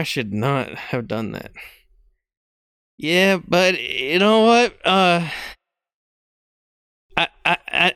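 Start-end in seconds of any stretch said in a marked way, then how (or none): none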